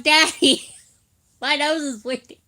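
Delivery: high, loud mock wail to dad, broken by laughter; hammed-up plea to daddy through giggles; imitated child calling. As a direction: call out like a kid, cracking up.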